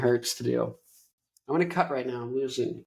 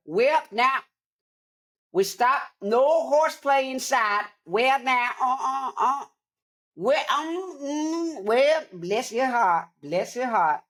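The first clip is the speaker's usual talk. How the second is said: Southern grandma impression; 10 semitones higher, slower than usual. Grandma telling kids no horseplay inside, hammed-up Southern accent. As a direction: do a scolding Southern granny, pitched way up. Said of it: "whatever Southern impression I could muster up".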